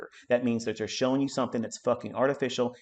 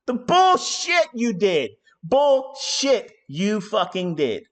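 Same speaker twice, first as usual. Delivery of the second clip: much higher, louder, slow and drawn out; furious call-out; 8 semitones higher, 5 dB louder, much slower than usual. Real, not acted. Accusing fake forex gurus, furious and slow. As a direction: spit out the accusation.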